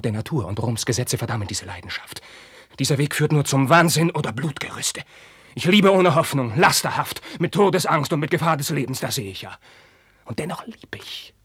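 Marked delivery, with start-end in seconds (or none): 2.8-9.6 much higher, much louder cry; high, loud, desperate confession; anguished outcry. Acted. Carlos confesses his passion. 10.3-11.3 high whispered avowal; whispered, helpless confession. Acted. Carlos confesses his love.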